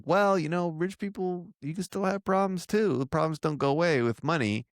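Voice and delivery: a hint of preachy Lorena; voicing Lorena. Podcaster quoting Lorena warning friends about money, mock preachy. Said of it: "Lorena's like".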